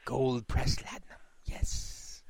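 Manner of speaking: slow, hushed greedy alien voice; Ferengi. Acted. Imagined Ferengi coveting latinum, slow hushed role voice. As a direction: savor it slowly, almost whispering. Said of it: "if it was the Ferengi".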